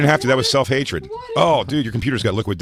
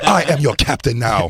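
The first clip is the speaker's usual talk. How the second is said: slow, loud mock pirate captain; a hint of a pompous captain, slowly; 3 semitones higher, 4 dB louder than usual. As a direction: announce it like a hammed-up captain.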